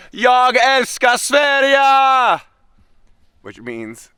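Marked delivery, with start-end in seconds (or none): 0.1-2.4 long, drawn-out tearful yell in Swedish, much higher and louder; screaming goodbye. Retold or imitated. Leaving host family at airport, screaming back slowly and loudly. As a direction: belt it out slowly across the airport as you walk away. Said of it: "just screaming back".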